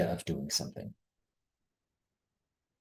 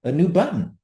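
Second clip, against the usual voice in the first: mock excited presenter; 9 semitones higher than usual. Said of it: "me clicking a button and saying".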